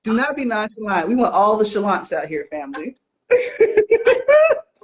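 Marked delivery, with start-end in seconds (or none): 3.3-4.7 bursts into high laughter; high laughing. Real, not acted. Cohost laughs high at her own chalant joke.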